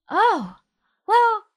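high, drawn-out oh, well; wondering oh. Acted. GM acting out Priscilla's daydream to the players: high wondering voice. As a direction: lift the voice up high in dreamy wonder. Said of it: "you look up and go".

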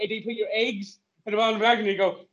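ordinary talk, nothing marked

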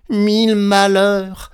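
slow, loud, high wail; cri de malheur. A narrator's reading. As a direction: cry out in dismay, stretching it. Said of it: "s'écria-t-il". (lamentation criée, lente et aiguë)

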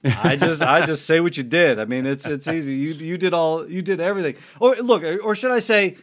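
ordinary talk, nothing marked

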